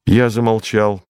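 ordinary delivery, nothing marked

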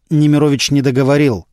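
plain reading voice

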